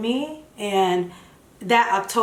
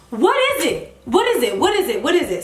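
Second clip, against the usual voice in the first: rising frustration, repeating the question higher and pressed; frustrated questioning; 6 semitones higher than usual. Retold or imitated. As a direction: ask it over and over, fed up.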